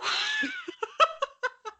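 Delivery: bursts into high-pitched laughter; big delighted laugh; loud high laugh. Real, not acted. Hearty laugh after teasing.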